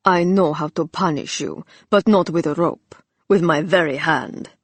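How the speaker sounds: mock villain in an overdone Turkish accent; hideous Turkish accent. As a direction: ham up a bad Turkish accent, savouring each word. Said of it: "adopting a hideous Turkish accent".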